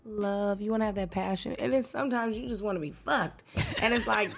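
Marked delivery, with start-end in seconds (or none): none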